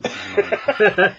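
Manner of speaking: loud, high, slow annoyed drawl; exasperated. Retold or imitated. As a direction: groan at your own rambling. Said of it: "I was like".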